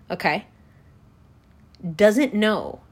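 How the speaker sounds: normal talking voice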